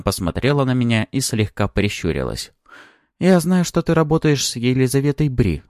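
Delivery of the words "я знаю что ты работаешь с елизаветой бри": high-pitched female character voice; probing woman. A narrator's reading. Lida questioning Maxim off the record, high and probing. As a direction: lift the voice high, knowingly probing.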